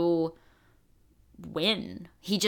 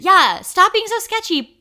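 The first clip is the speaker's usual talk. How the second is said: much higher, much louder burst; exasperated outburst; 12 semitones higher, 10 dB louder than usual. Real, not acted. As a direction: blurt out the exasperation. Exasperated echo of friend.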